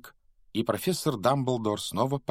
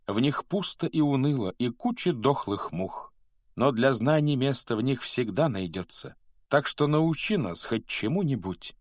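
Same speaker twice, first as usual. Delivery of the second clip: higher, rhythmic anthem recitation; verse recital; 3 semitones higher than usual. Acted. Pupils bellowing their school anthem, recited rhythmically. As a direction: declaim it line by line like a school verse.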